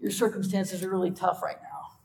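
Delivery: soft, high, hand-wringing sympathy; mock helpless pity. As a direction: play a powerless comforter, softly. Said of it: "He doesn't say".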